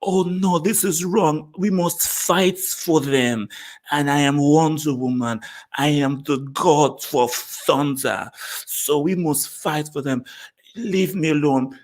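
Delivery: mock bad acting, slow and laboured; drawn-out, hammed-up superhero declarations; Gal Gadot. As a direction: imitate a stilted heroine with a heavy accent. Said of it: "But then Gal Gadot said".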